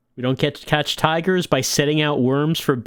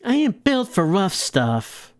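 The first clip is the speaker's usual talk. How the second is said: slow, higher dame voice; reluctant moll; 4 semitones higher, slower than usual. Acted. Moll refusing the hit job, slow and high. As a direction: drag it out like a reluctant gangster's girl. Voicing the gangster's girl.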